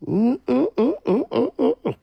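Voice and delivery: high, repeated mm-mm; disapproving hum. Real, not acted. Reacting to [name] telling, high disapproving hum. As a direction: hum your disapproval in short high pulses.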